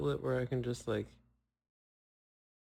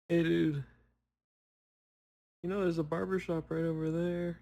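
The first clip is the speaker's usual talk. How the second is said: mock heckling, pitched up; roasting himself; 5 semitones higher, 3 dB louder than usual. Speaker heckles himself about a haircut, mock roasting. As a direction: call out a jokey nudge. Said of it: "I'm just roasting myself".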